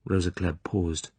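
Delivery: plain reading voice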